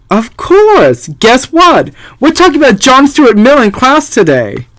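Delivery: lightly voiced excited girl; eager daughter. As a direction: chirp it like a keen kid. Voicing Ari the daughter.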